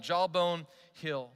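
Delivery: normal talking voice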